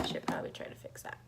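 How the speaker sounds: quick unvoiced whisper; ASMR whisper. Real, not acted. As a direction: breathe it out as ASMR. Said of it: "A S M R".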